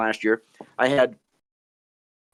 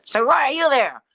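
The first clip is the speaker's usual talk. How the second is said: high-pitched heckling puppet voice; very high, needling puppet; Jerry. Acted. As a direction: cut in as a cheeky ventriloquist's dummy. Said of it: "Jerry, I know".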